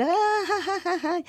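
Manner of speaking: high mock baby laugh; imitating a newborn's laugh. Singer imitating herself as a laughing newborn, high. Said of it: "yo en vez de decir".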